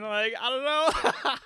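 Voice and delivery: high, slow, laughing through the words. Real, not acted. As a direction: laugh it off, drawing it out. Laughs at the absurd question.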